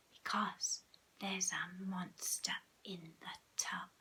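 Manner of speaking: slow, breath-only warning; hushed, ominous and drawn out; whisper. A narrator's reading. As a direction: whisper a scary secret. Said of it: "Why are you whispering?".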